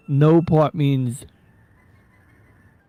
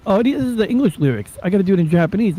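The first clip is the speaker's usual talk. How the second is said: normal talking voice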